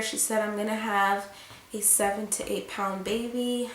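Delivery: usual speaking voice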